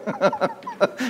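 real amused high laugh. Real, not acted. Preacher laughs at Martha.

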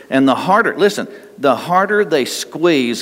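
normal talking voice

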